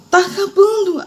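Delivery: very high, wailing "Tá acabando."; "Tá acabando." dito bem agudo, num lamento; high pleading whine. Retold or imitated. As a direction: cry it out high, at the end of your strength. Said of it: "E bem assim, ó".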